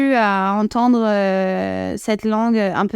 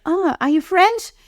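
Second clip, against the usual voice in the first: much higher, slow, thrilled question; delighted local; 8 semitones higher, slower than usual. Retold or imitated. Shop clerk hearing a French accent, high and delighted. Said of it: "et ben, ils".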